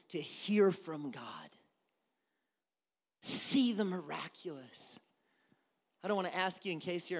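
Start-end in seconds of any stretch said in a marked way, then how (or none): 0.1-5.0 slow unvoiced whisper. Real, not acted. Preacher hushing for the longing, slow whisper. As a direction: let it fall to a breath.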